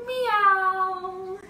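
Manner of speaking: long, sustained playful meow; cat meow. Pretend. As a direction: stretch the meow out and let it slide. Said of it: "like a cat and say meow".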